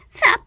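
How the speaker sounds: sharp high yelp as she falls; a startled shout mid-fall; cry of pain. Acted. Ballerina pushed over by big sister, yelping.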